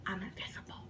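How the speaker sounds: hushed, voiceless whisper; barely audible, conspiratorial; acted secretive whisper. Stoned secret claim to cellmates.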